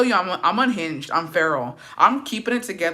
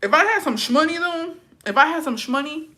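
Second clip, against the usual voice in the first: lightly put-on high joking voice; playful voice; 11 semitones higher, 4 dB louder than usual. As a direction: tease it higher and louder.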